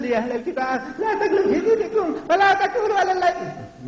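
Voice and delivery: high-pitched mock chanting; mocking meek recitation. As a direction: parody a feeble reciter in a squeaky high voice. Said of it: "My Allah doesn't talk like that".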